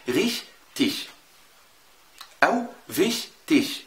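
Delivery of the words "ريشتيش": ريشتيش بشين خفيفة في الآخر; قول ريشتيش بشين خفيفة خالص; light ich. Shown as a demonstration. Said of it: "بتنطق إزاي؟". (نطق ريشتيش بشين خفيفة)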